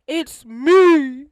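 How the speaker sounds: calling out. Retold or imitated. Security guard to yelling Jonathan, calling out loudly. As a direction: call out to get through to him.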